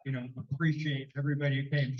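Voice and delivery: ordinary talk, nothing marked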